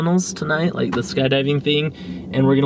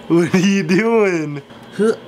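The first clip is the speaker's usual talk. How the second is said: ordinary delivery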